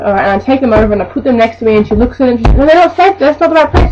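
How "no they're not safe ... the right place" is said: much higher, louder indignant protest; mock fussy customer telling the cashier off; fussy customer objection. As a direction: squeal that the bags aren't safe. Customer objecting to bag placement.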